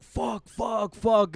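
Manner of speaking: building from scared to yelling; panicked cursing. Retold or imitated. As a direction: let the panic burst out. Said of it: "and we just like".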